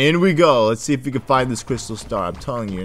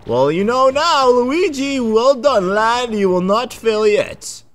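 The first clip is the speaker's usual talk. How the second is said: pompous mentor; 10 semitones higher, 4 dB louder, slower than usual. Acted. Old mentor voice praising.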